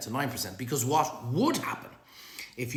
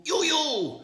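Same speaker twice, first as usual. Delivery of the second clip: very high, loud echoing you, you; silly high-pitched birthday echo; squeaky echo; 4 dB louder than usual. Pretend. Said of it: "And I'm saying".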